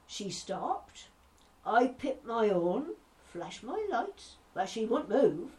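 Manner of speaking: comic Norfolk yarn-spinner, swooping pitch, acting both sides; old Mother Miller. Norfolk ex-copper recounting daft encounters, animated comic storytelling. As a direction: tell a funny anecdote in broad Norfolk. A little bit of Norfolk squit.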